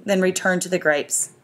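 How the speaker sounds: ordinary delivery, nothing marked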